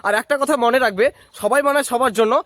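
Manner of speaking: loud upbeat sign-off; energetic outro. Acted. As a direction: pitch it like a YouTube outro. (উচ্ছ্বসিত সমাপ্তি ঘোষণা)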